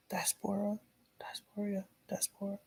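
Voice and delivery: breathy whispered diaspora, diaspora; whispered repeat. Pretend. Mocking the word to the camera in a whisper.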